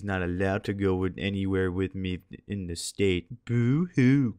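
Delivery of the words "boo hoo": playful fake pout; put-on self-pity; mock boo-hoo. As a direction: draw out a mocking boo-hoo. Mock crying over absent girlfriend.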